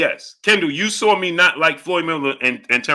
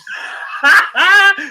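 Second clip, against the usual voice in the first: loud, high cackle; real uncontrollable laughter; real laughing fit; 5 dB louder than usual. Real, not acted. Breaks up quoting viewer.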